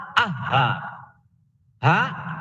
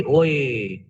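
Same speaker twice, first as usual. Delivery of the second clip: normal talking voice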